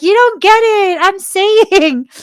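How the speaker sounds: high, loud insistent friend. Retold or imitated. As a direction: friend who won't let it go. Friend insisting in retold chat.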